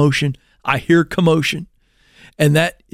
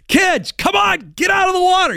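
panicked shout; 12 semitones higher than usual. Retold or imitated. Father yelling to his kids in the water, panicked high shout. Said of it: "I panicked".

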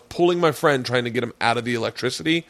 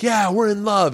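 high, drawn-out declaration; mock proud lover; 9 semitones higher than usual. Friend showing off to heckler, mock proud declaration. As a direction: proclaim love to the heckler, hammed-up.

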